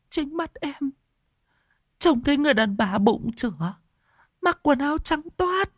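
high, trembling, terrified account. A narrator's reading. Wife tells husband of the pregnant ghost stealing the child, terrified. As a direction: tell it as if still seeing the ghost.

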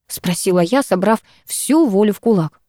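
usual speaking voice

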